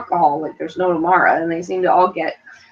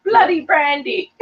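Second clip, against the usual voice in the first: mock British; 9 semitones higher, 3 dB louder, slower than usual. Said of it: "as your mom will say".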